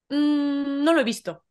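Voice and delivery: mock-innocent denial after a little hum; disimulo fingido. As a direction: feign not having noticed. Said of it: "es como disimular y decir". (haciéndose el loco)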